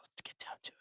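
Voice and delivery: unvoiced whisper; hushed, conspiratorial intro; whispered topic change. Real, not acted. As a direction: whisper the next segment like a teaser.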